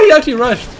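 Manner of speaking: high, loud shocked call; startled exclamation. Real, not acted. Player just rushed by an enemy, loud surprise. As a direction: blurt it out loudly after a scare.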